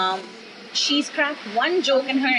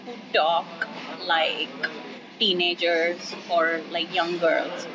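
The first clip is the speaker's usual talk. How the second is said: usual speaking voice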